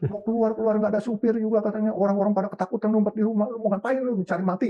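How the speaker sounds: high-pitched, agitated retold scolding; angry wife. Wife scolds him for going out during the riot, angry. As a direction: imitate an angry wife's high-pitched rant. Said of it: "Nyonya saya marah".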